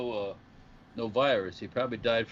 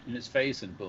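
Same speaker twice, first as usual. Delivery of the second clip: ordinary talk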